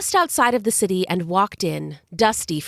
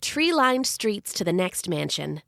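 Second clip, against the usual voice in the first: usual speaking voice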